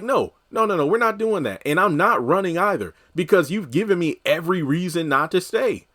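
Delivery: Preston putting his foot down. Retold or imitated. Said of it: "And he's like".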